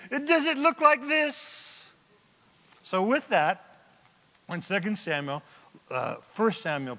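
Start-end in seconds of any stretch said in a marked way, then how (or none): 0.1-1.9 high, loud, drawn-out question; shouting from afar. Acted. David shows Saul the missing piece from afar, shouting. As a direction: holler it slowly so it carries. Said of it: "from some distance away".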